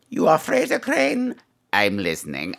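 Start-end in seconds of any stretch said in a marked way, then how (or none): 0.1-1.4 higher incredulous take. Acted. Repeated line, higher take.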